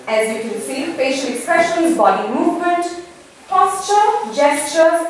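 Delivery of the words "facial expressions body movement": usual speaking voice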